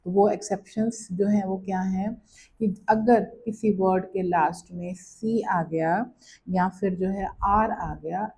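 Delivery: ordinary talk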